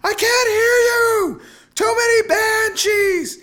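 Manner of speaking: high, drawn-out scream over a din; wife shrieking over deafening noise. A narrator's reading. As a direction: scream slowly to be heard, very high. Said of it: "his wife screamed".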